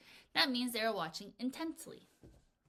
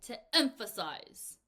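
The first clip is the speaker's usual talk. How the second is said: slow, loud "emphasize"; louder for emphasis; 6 dB louder, slower than usual. Shown as a demonstration. Teacher showing what the word means, "emphasize" loud and slow. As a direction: punch "emphasize", louder and stretched out. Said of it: "I said it in a louder voice".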